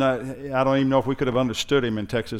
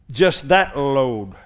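slow emphatic repeat; 5 dB louder, much slower than usual. Acted. Slowed, repeated phrase.

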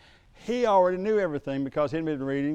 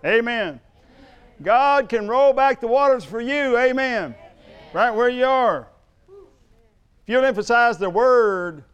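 forceful sermon delivery with punched key words; preaching; 4 semitones higher, 6 dB louder than usual. Real, not acted. Preacher urging church to emphasize the word, forceful preaching. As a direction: preach it out to rouse the congregation.

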